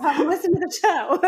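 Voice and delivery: laughing through the words; a real laugh bubbling up. Real, not acted. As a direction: giggle while you say it. Host laughing at herself.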